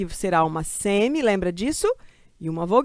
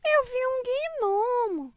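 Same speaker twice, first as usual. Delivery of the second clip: mock wonder; 4 dB quieter, much slower than usual. Playful voice saying she saw gnome.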